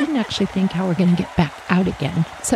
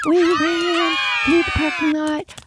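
high, yelled charge, far higher; screaming for courage; 10 semitones higher than usual. Retold or imitated. Two girls charging the lake, screaming high. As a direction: shriek it out while running to the lake. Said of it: "we just start screaming".

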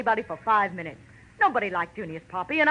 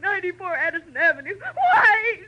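high, loud tearful cry; hysterical sobbing; 9 semitones higher, 6 dB louder than usual. Acted. Louise gives Roper's address to Marlowe, still sobbing. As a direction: blurt the address through sobs. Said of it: "Get good and hysterical".

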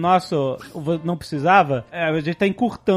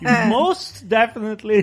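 slow, higher, overdone emphasis; even worse thanks; 4 semitones higher, slower than usual. Pretend. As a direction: drag out "most definitely", pitched up. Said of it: "Tá ainda pior ainda". (ênfase exagerada, lenta e mais aguda)

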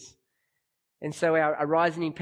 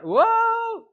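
surprised whoa; 7 dB louder than usual. Real, not acted. Exclaims at how they turn out.

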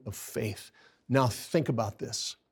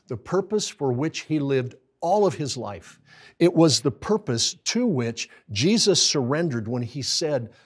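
normal talking voice

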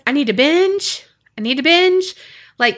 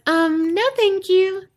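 high, careful no-thank-you; lightly acted nervous politeness; polite refusal; 7 semitones higher, slower than usual. A narrator's reading. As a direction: decline sweetly in a higher voice. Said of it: "What I said was".

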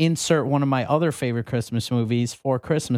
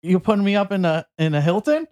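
higher, fast hammed-up outrage at the desk; mock indignant customer; 5 semitones higher than usual. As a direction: play the offended traveler, quick and high. Quoting the airport scene.

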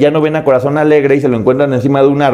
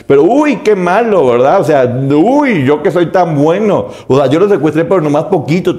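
sarcastic mockery; 5 semitones higher than usual. The vlogger mocking Vitali as a kidnapper, sarcastically high.

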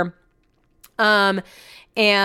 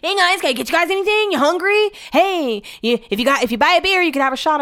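much higher, fast, bubbly server voice; chipper server; 9 semitones higher than usual. Retold or imitated. Mocking the too-chipper airport server offering drinks. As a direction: sell it like an overly cheerful early waiter. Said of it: "way too chipper to be working there".

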